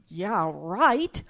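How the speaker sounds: amused, scoffing retake after a laugh; sarcastic scoff. Retold or imitated. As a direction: toss it off with a mocking edge. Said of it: "I thought".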